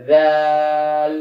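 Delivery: দাল stretched very slowly and evenly; drawn out. Shown as a demonstration. Teacher drilling the class on slow drawn দাল. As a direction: pull দাল out long, unhurried. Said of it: "এটা বোঝানোর জন্য দেওয়া হয়েছে".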